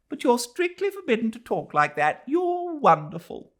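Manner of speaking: high, gushing praise; admiring lady's voice. Acted. Nancy praising Adela after the trial, high gushing voice. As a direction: pour on the admiration.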